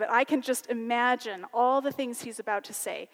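ordinary talk, nothing marked

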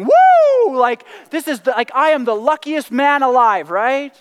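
loud, high whoop into giddy boasting; excited teenage son. Retold or imitated. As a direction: shout like the luckiest kid alive. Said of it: "he's bouncing off the walls".

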